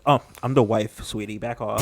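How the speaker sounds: low, softly delivered mock sass; mock sassy. Husband imitating his new wife warning someone off, understated sass. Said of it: "Probably a bit more sassier when he's like".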